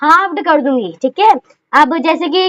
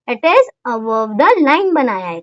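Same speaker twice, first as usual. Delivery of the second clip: slow answer; 6 semitones lower, slower than usual. Shown as a demonstration. Teacher stating the answer.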